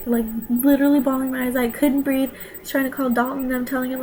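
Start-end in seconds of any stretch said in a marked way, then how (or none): none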